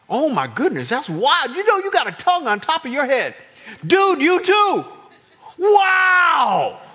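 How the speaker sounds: high, loud acted astonishment; amazed outburst. Disciple spotting fire on a friend's head, amazed. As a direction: blurt it out in mounting wonder.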